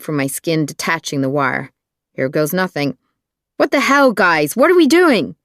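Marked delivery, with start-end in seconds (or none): 3.6-5.4 high, loud outraged question; staged yelling. Acted. Woman confronting her abductors mid-ride, loud high-pitched outburst. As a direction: yell it at the whole car.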